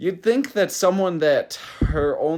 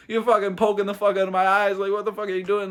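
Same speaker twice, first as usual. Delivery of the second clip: mock screaming; 7 semitones higher, faster than usual. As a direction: imitate an outraged fighter yelling trash talk. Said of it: "he was, like, screaming, like, fucking talking shit".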